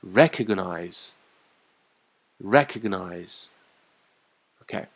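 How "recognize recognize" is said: RE-cognize stressed on the first syllable, slow; slow drilled recognize, first syllable stressed. Shown as a demonstration. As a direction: say recognize with the weight on the front, slowly. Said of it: "recognize重音在最前面".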